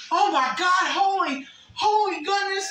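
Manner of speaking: loud relieved gush; relieved exclaiming. Retold or imitated. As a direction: burst out in relief.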